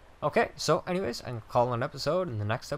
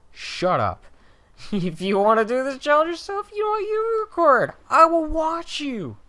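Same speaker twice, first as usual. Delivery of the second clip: high, loud mock outrage; hammed-up high-pitched challenge; mock indignant retort; 8 dB louder than usual. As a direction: fire back at the nagging viewer, high and loud. Mock retort to complaining viewers.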